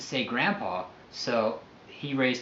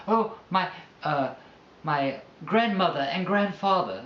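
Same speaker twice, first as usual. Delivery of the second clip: higher, slower put-on British voice; mock British accent; 4 semitones higher, slower than usual. Saying what people call grandparents, mock British accent. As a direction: play at proper British, drawing out the words. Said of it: "It sounds like British".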